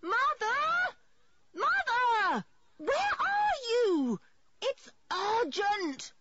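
high, drawn-out urgent holler; top-of-voice yell. A narrator's reading. George searching for mother, yelling at the top of her voice. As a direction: stretch each word in a very high shout. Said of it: "at the top of her very strong voice".

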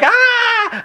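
high imitated pain shriek; shrieking scream. Retelling how the injured wrestler screamed, imitated shriek. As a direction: mimic an injured man screaming. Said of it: "it's just this shrieking scream".

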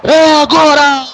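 screamed goal call, high and loud; elongated, drawn-out goal celebration shout; explosive goal commentary. Real, not acted. Goal scored, commentator erupts.

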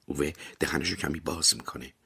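ordinary delivery, nothing marked

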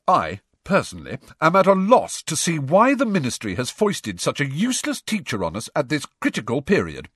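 lightly voiced orating student, indignant emphasis; indignant Ernie. Student complaining of a useless teacher, emphatic indignation.

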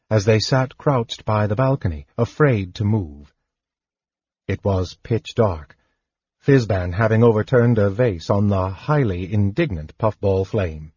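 ordinary delivery, nothing marked

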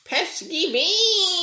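very high, slow laughing squawk; shrill bird voice. Retold or imitated. Teasing the cohost with the bird's line, laughing squawk. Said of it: "the bird from Don't Hug Me".

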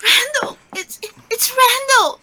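high, panicked cry, stumbling over words; distraught and high-pitched, near tears; frantic alarm. Acted. Frantic alarm raised to the doctor.

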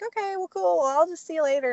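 high breezy sign-off; fake casual. Hiding news, ending call breezily.

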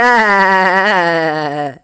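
loud mocking laugh. A narrator's reading. Policeman laughs at the woman, loud laugh. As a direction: laugh out at her.

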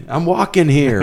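high, loud Brooklyn street yell; Brooklyn accent. Retold or imitated. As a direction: Brooklyn accent, yelling "I'm walking here". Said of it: "From, uh, Son of a Woman".

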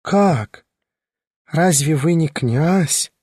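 high, astonished madwoman; madwoman, surprised. A narrator's reading. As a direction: gasp in disbelief, high and slow.

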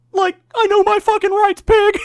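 high, loud stoner whine; Shaggy impression. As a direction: squeak it like a panicked Shaggy. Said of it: "Shaggy was like".

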